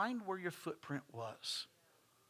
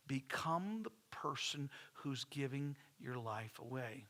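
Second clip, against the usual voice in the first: softer and slower, each word laid down gently; soft, slow restatement; 7 dB quieter, slower than usual. Shown as a demonstration. Pastor repeating his point softly so the congregation hears. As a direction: say it quietly so it sinks in. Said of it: "Let me say it again".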